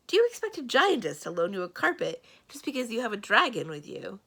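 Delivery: loud, incredulous demand. A narrator's reading. Mendenbar challenges Samarine's plan, loud and demanding. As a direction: push back hard in disbelief. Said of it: "he demanded".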